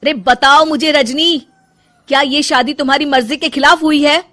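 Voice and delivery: exasperated husband, voice up; insistent demand. Acted. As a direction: press your wife for the truth. Husband presses wife for answer.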